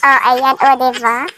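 talking while eating egg; chewing between words; mouth full, chewing. Real, not acted. Seen 0: Eats balut, grimaces with food in mouth, hand near mouth.

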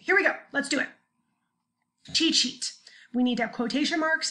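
normal talking voice

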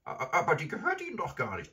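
high, quick, stammering protest; timid grandmother. Acted. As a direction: object nervously in a high old-lady voice. Frightened grandmother objects to robber.

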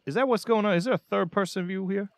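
high, incredulous questions; surprised. Real, not acted. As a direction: disbelief at the lookalike. Startled at lookalike character.

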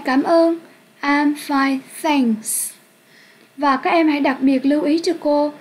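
ordinary talk, nothing marked

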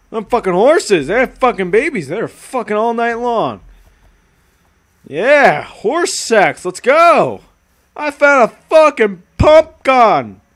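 loud, high gleeful chatter; excited. Real, not acted. Streamer thrilled by horses and a new gun, excited.

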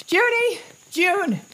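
a frantic high call across the parking lot; desperate shout. Acted. Father screaming for his lost daughter, frantic shout. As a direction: yell her name, panicking.

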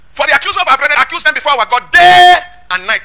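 high, loud pulpit proclamation; ringing preacher's declamation; forceful scripture reading. Acted. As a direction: read the verse out at full force, stretching DAY. Rereads verse at full force.

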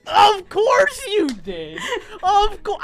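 high, loud, slow laughing wail; loud, drawn-out mock despair; laughing disbelief. Real, not acted. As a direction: cry out in laughing exasperation. She beat him in one.

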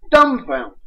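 ordinary delivery

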